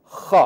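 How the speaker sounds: خ held as a rasping throat sound; sound demo. Shown as a demonstration. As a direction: hiss out خ from the back of the throat. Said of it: "پہلی آواز ہے خ". (خ کی آواز)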